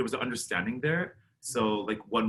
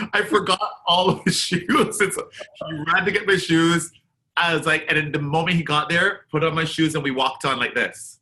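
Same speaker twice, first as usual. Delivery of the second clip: excited retelling; 5 semitones higher, 6 dB louder than usual. Real, not acted. Excited retelling of shoe rush.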